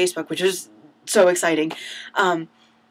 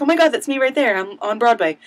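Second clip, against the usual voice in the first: excited gush; 5 semitones higher, 6 dB louder than usual. Pretend. Imagines seeing herself on video.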